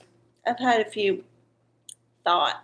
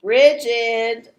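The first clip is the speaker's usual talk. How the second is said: normal talking voice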